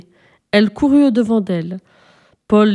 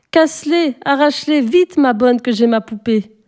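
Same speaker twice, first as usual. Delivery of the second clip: put-on high, pushy child voice; a child who can't wait another second; higher, demanding child; impatient little girl; 4 semitones higher than usual. Sophie impatient for the doll.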